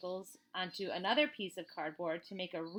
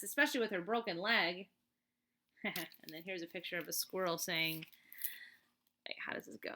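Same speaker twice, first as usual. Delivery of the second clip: ordinary delivery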